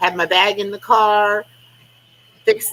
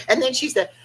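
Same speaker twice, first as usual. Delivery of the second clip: ordinary delivery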